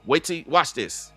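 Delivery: ordinary delivery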